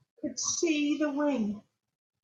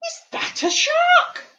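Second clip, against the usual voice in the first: high, loud startled question; alarmed cry; 7 dB louder than usual. Acted. Reading to children, sudden loud alarm. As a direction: gasp it out as if a shark appeared. Reader gasps at the picture.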